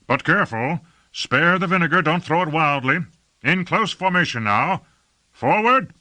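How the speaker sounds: high commander's call, building to the last word; calling out orders. A narrator's reading. Lord directs troops carrying vinegar, calling out orders. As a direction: call orders to the ranks, rising to a shout. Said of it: "called Lord Fayed".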